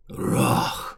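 agonized beast roar, deep and rasping; deep distorted monster roar. Acted. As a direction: howl like a monster losing its arm. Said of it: "Рёв монстра, полный ужаса и муки".

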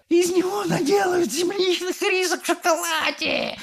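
very high hysterical shriek; wailing scream. A narrator's reading. Mother fearing for her son, wailing. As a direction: wail it out as a panicked mother. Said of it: "вопила миссис Глуп".

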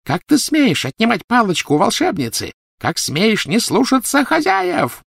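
high-pitched indignant tirade; screaming outrage. A narrator's reading. Witch berating a disobedient elf, screaming outrage. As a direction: scold in a high screech, dragging out the end. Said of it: "зашлась криком Белатриса".

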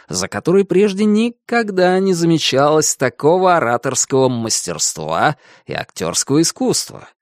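ordinary delivery, nothing marked

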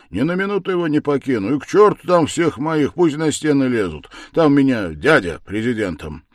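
loud, high, hurried outburst; crying out. A narrator's reading. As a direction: keep exclaiming with force. Razumikhin keeps on crying out.

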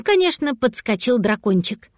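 ordinary delivery, nothing marked

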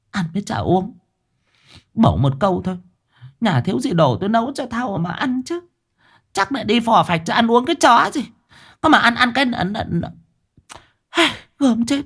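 put-on high, scornful old woman muttering; grumbling old wife. Wife grumbles alone after husband drives off, high scornful voice. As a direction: complain bitterly to herself.

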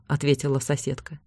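ordinary delivery

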